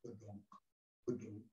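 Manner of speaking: very low, soft frog croak; low, hushed bullfrog imitation; bullfrog call. Shown as a demonstration. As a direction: drop deep down like a bullfrog. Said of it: "the bullfrog that goes".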